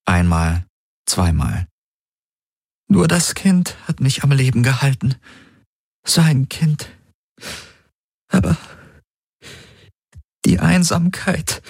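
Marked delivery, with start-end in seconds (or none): 2.9-11.6 raised, wavering voice near tears; fighting back tears as she remembers; tearful. A narrator's reading. Ylana confides her loneliness to Galen, close to tears. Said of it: "kämpfte, die Tränen zurückzuhalten".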